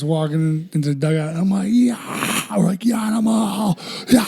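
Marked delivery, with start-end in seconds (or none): 1.7-2.6 high-pitched mock yell; retold scream. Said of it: "I was screaming". 2.8-4.3 high mock holler to a friend; retold scream. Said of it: "I was screaming".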